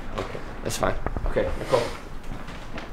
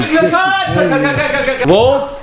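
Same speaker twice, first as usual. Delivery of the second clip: yelling cut over and over, much higher and louder; shouting; 10 dB louder, slower than usual. Real, not acted. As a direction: bellow it to stop the take. Director stops the take.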